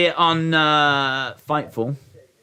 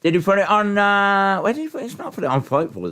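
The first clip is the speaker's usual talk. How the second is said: mocking voice; 7 semitones higher, faster than usual. Mimicking confused chat.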